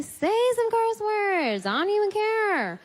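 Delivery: daring an angry yeller to curse, mock indifferent; mocking dare. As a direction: dare them to curse, unbothered. Dares someone to curse.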